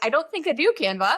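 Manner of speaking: sarcastic answer to Canva; sarcastic reply. Retold or imitated. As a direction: talk back to the app sarcastically. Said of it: "And I'm like".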